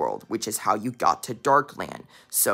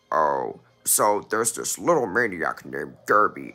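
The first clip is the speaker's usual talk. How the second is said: deep villain voice; 6 semitones lower than usual. Acted. Dark double explains.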